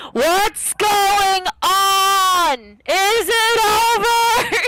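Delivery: loud yelling in your face. Acted. Cyril yelling at Diego, deafened and panicked, loud slow shout. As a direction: bellow it at him like you can't hear yourself. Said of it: "He's just, like, really loud yelling in your face".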